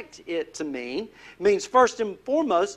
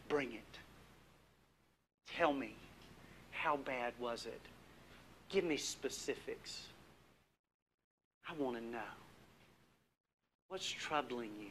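soft, much quieter, inviting; gentle Jesus voice; 9 semitones lower, 19 dB quieter than usual. Pretend. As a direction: lean in and coax them to share, very softly. Voicing Jesus' gentle reply.

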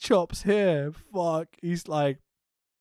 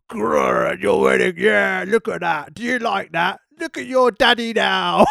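hammed-up heel gloating, louder and laughing; mock heel taunt; 2 semitones higher, 6 dB louder than usual. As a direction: gloat over the beaten groom like a cartoon villain. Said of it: "Gallows is going".

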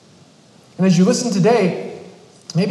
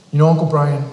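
put-on serious teen; 4 dB louder, faster than usual. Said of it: "he gets real serious".

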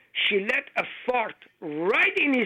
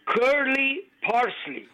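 curly parsley spelled out slowly and higher; slow correction; 6 semitones higher, slower than usual. Shown as a demonstration. Caller correcting the host, slow and high on curly parsley. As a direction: stretch curly parsley out so it can't be misheard. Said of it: "No, I said".

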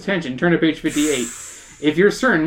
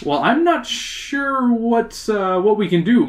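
drawn-out, higher-pitched musing; thoughtful; 7 semitones higher, slower than usual. Acted. Mister Scully unsure how to help, thoughtful. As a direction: hesitate and stretch the words, pondering. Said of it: "Mister Scully answers thoughtfully".

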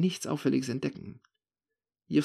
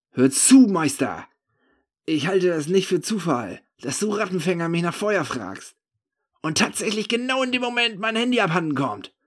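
loud, raised-pitch accusation; mounting anger; 7 semitones higher, 6 dB louder than usual. A narrator's reading. Drunk narrator accusing the man of theft, mounting anger. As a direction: lay out the accusation with anger pressing through. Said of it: "sich in mir eine unbändige Wut anstaut".

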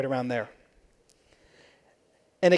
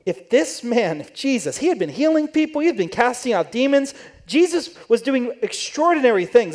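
higher, doubting inner voice; sceptical Pharisee; 6 semitones higher than usual. Retold or imitated. Pharisee privately doubting Jesus as guest, raised scornful pitch. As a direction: question Jesus in your head, a touch mocking.

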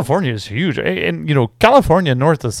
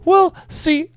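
imitating a friend; 8 semitones higher than usual. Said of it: "It's like".

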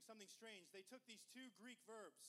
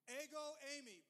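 proclaimed loudly; 4 semitones higher, 8 dB louder than usual. Acted. Preacher proclaiming Jesus's words to the congregation, loud. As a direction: ring out Ego, eimi, louder, like a declaration.

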